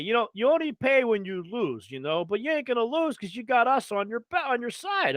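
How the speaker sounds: salesman voice. Retold or imitated. Tout making a sales pitch.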